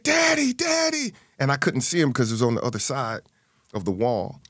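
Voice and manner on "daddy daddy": put-on high childlike call of daddy; high little girl calling daddy. Little sister calling her father.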